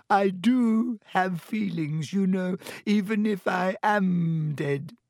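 high, drawn-out tearful self-pity; choked with tears. A narrator's reading. Myrtle hurt about being dead, tearful. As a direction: stretch the words into a weepy wail. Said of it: "in a voice choked with tears".

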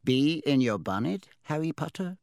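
small sly hat voice; talking hat. Acted. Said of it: "a small voice said in his ear".

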